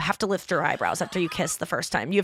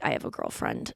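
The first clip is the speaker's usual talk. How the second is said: put-on very low, half-whispered boy voice; 10 dB quieter than usual. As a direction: drop the voice deep and breathy. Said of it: "he had this really low voice".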